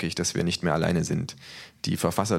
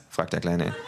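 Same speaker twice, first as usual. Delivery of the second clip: ordinary delivery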